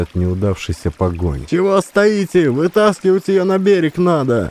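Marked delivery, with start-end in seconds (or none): 1.5-4.5 high shouted order; yelling. A narrator's reading. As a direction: bark at the bystanders. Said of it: "заорала она на всех стоящих". (баба орёт на стоящих, громко)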